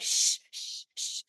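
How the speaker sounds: long hissed shushes; imitated shushing. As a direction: shush like an annoyed audience. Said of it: "people around me went".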